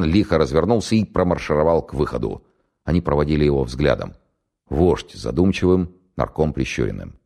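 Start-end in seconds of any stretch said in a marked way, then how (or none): none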